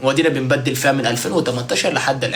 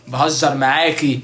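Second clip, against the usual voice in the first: normal talking voice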